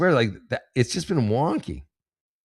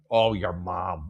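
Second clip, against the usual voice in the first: ordinary delivery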